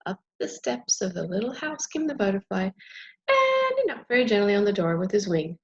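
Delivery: plain reading voice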